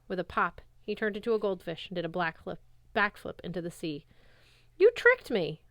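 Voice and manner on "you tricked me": high, loud, angry yell. Acted. Percy yelling at Nereus who tricked him, high and loud. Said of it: "I yelled".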